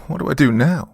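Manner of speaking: high, mildly tearful plea; a touch of sobbing, lost. Acted. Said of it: "sobbed Dawn".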